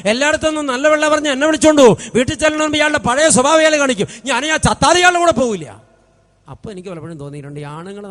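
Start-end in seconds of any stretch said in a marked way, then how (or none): 0.1-5.7 higher, fast, heated refusal; wife refusing to go, raised and rushed; defiant wife. Retold or imitated. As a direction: play the stubborn wife snapping back at the priest. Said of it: "സഹോദരി, നീ എന്താ തീരുമാനിക്കുന്നത്?".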